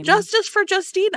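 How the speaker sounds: squeaky pleading chant; high mock plea. Host retells begging the author, in a high mock plea. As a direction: plead it up high, half joking.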